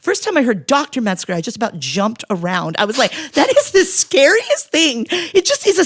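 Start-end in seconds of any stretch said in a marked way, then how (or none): 3.3-5.8 high, slow mock fright; mock horror. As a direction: pretend to be scared.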